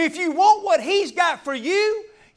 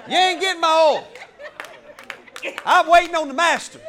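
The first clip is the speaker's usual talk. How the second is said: louder, firm mock refusal with a laugh; bold refusal; 3 semitones higher, 6 dB louder than usual. Wise virgin refuses to lend her oil, loud and bold. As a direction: refuse flat out, proud and bold.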